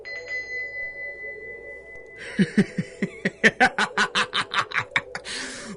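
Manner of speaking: high drawn-out villain cackle; evil laugh. Acted. Villain gloating after the customer leaves, evil cackle. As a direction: cackle in triumph over the fools.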